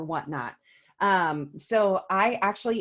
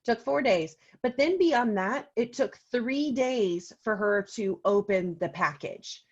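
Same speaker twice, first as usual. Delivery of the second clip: usual speaking voice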